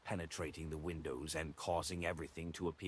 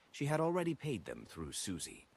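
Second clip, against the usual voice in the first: ordinary delivery, nothing marked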